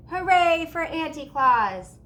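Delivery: high, loud, slow cheer. A narrator's reading. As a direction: cheer like a whole crowd. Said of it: "Everyone cheered".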